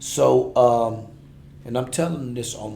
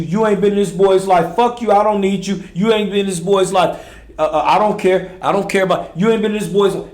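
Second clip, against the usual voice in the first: loud, high repeated accusations; escalating role-play shouting; 5 semitones higher, 10 dB louder than usual. Acted. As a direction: build the fight in the reenactment.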